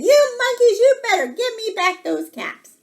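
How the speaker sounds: indignant start, easing slightly by the end; angry peddler. A narrator's reading. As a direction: warn the monkeys in play-acted anger. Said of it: "He was quite angry".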